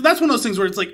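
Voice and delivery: ordinary delivery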